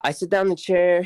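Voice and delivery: ordinary talk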